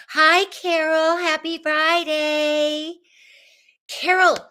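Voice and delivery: high, drawn-out, sing-song hello; excited greeting. Real, not acted. Host welcoming Carol to the live, excited sing-song. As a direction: call out high and stretched to a friend.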